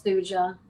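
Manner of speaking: Thuja with a J sound in place of Y; husband's pronunciation. Retold or imitated. Wife quoting how her husband says it, Thuja with a J sound. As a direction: pronounce Thuja as THOO-juh, J in the middle. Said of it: "He tells me I say it wrong".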